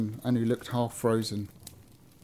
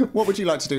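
playful higher Cockney voice; Cockney accent; 7 semitones higher, 4 dB louder, much faster than usual. Pretend. Reader riffing on the eating shop, playful Cockney. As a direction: improvise in a light Cockney. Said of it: "stick with him being a Cockney".

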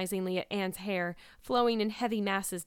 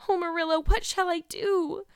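tearful; 10 semitones higher, 6 dB louder than usual. A narrator's reading. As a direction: cry out helplessly, close to tears. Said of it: "questioned Anne in tears".

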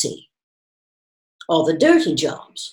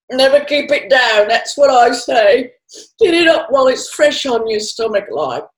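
put-on drunk old woman; 6 semitones higher, 6 dB louder than usual. A narrator's reading. Drunk woman giving advice.

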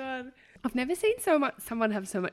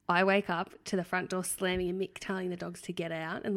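ordinary talk

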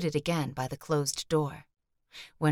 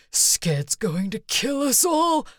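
slow, higher and louder straining for a shout; strained shout; 7 semitones higher, 5 dB louder, slower than usual. A narrator's reading. The wounded man warning Brick in a strained half shout. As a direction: push out a weak shout with all he has left. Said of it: "as close to a shout as he could muster".

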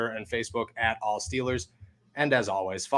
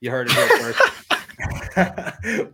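announcer voice; 5 semitones higher, 4 dB louder, much slower than usual. Acted. Closing hype line.

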